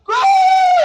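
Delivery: big commentator yell of gol; shouted goal. Pretend. Teacher to class, yelling like a football commentator. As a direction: roar it like a stadium announcer.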